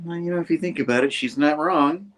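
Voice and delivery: high, fast then slowing aside; quick mock concession. Harry conceding Gwen's point over wine, fast high mock aside. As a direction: rush it, then land it smugly. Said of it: "He's like".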